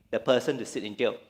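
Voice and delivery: ordinary delivery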